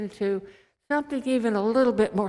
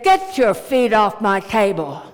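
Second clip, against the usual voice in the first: scolding mother; 7 dB louder than usual. Retold or imitated. Mother-in-law scolds the president.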